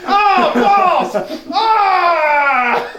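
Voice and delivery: hammed-up pained outburst; mock pained yell. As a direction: yell it as if you just took a hit. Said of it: "you're just sitting there going".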